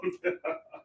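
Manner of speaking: short chuckle after the outburst; laugh. Real, not acted. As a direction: chuckle at your own silliness. Laughs after own scream.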